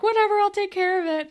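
high, fast dismissive quote; a quick, high, airy dismissal; mock brush-off. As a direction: rattle it off breezily and high. Imitating Holly J's brush-off.

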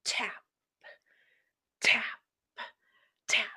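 hushed taps, spaced out then speeding up; building tap rhythm. Retold or imitated. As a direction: imitate tap steps, accelerating into a rapid run. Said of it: "the tempo is building".

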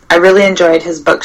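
usual speaking voice

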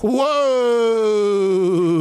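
long, high drawn-out whoa; mock astonishment. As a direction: stretch it out in exaggerated shock. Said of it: "I'm like".

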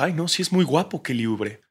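high, soft, lovestruck gushing; swooning referee. Retold or imitated. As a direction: gush over him like a smitten fan. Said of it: "el árbitro se puso nervioso y dijo".

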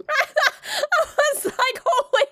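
cry-laughing, barely getting it out; helpless laughter. Real, not acted. Losing it while telling her friend, cry-laughing.

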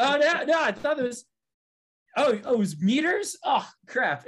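lightly voiced high clueless guy; mock dumb guy. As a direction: play it as a dopey realization. Imitating guys realizing meters mistake.

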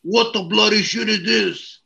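put-on a botched British chef accent, shouted; shaky mock British accent, half Mexican; Gordon Ramsay impression. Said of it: "that's Gordon Ramsay, and I messed it up".

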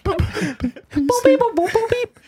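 high, chirpy robot beeps; beep-boop like a toy robot. Retold or imitated. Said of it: "are you the robot on bass".

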